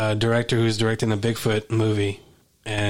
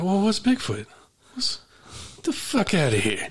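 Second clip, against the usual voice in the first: tough Bronx wiseguy voice; Chazz Palminteri impression; 8 semitones higher than usual. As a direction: play it like a New York tough guy. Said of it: "He'd be like".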